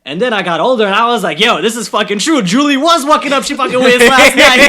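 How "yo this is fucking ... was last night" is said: put-on loud, much higher Guyanese accent. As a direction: quote mom's line in full Guyanese, louder and high. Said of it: "some things can only be said in that accent".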